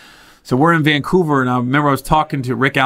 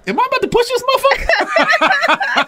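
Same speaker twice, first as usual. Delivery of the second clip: high, laughing retelling, close to a shout; cracking up while telling it. Retold or imitated. Laughing retelling of shoving match.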